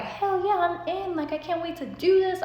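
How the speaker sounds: high, loud eager yes; excited. Retold or imitated. Accepting the mansion deal, loud and thrilled. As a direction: burst out with excitement. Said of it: "So I'm like".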